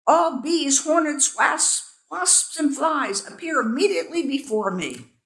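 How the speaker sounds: loud, high, furious royal summons; furious command. A narrator's reading. King summoning all insects, furious command. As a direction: bellow the order to every insect. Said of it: "he commanded with fury".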